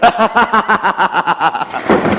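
slow, loud cackling laugh; a slow, loud manic laugh; mad laughter. Acted. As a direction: laugh madly over the words. Renfield laughs about his master.

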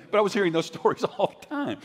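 laughing through the words, pitched high; chuckling as he tells it; real laughter breaking in. Real, not acted. Laughs while telling.

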